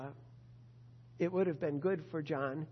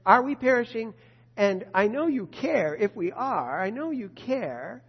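slower, steady and reassured; trusting request; 3 semitones higher, 7 dB louder than usual. Shown as a demonstration. Preacher models the trusting disciple's calm question. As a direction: ask calmly, trusting he cares. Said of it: "it should have been".

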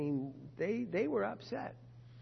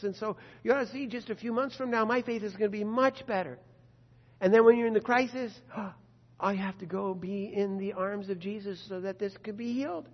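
preaching at full force; 4 semitones higher, 6 dB louder than usual. Acted. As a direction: drive it home to the congregation.

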